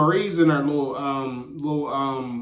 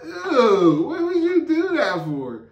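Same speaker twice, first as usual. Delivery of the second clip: high drawn-out mock disgust; grossed out; 10 semitones higher, slower than usual. Onlooker watching him lick chips, grossed out. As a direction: recoil with a high, whiny eww.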